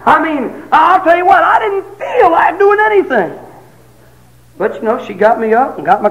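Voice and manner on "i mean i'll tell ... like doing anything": high laughing exclamation, louder; preacher laughing with congregation, high; laughing shout. Real, not acted. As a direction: laugh it out loud with the crowd. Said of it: "laugh shout".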